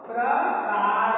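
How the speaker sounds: slow teaching demo. Shown as a demonstration. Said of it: "और किसमें use होगा?".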